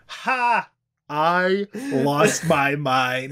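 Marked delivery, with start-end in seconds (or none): 0.1-0.7 a sharp, high mock "Ha!"; mock apple seller laugh. Reading the old comic's apple seller punchline, high mock laugh. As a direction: bark out one hammed-up laugh. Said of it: "Apple seller".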